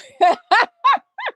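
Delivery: high, loud burst of laughter; big laugh. Real, not acted. Mother laughing at her own joke to her viewers. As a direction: let a loud laugh break out. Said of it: "I'm joking, you guys".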